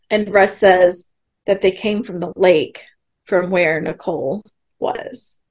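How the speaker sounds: normal talking voice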